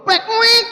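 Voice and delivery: high playful popping sound; high cartoon pop noise; squeaky mock pop noise. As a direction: make a quick silly birth noise. Mimics a baby popping out.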